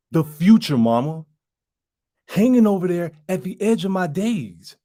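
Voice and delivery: put-on pleading grown child to Mama. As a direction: plead with Mama to understand, pausing between thoughts. Audition monologue addressed to Mama.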